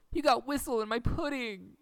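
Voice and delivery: high, loud comic retort; indignant yell. Retold or imitated. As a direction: yell it back just as outraged. Quoting Tapeworm back.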